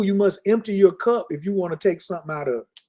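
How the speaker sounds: ordinary talk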